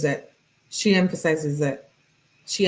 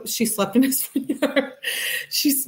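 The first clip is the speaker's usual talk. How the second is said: high, laughing through the words; breaking into a laugh while recalling it; amused giggle, pitched up; 7 semitones higher than usual. Real, not acted. Recalling the story's beginning, amused.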